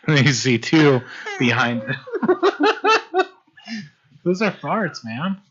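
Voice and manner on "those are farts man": laughing. Real, not acted. GM cracking up mid-description.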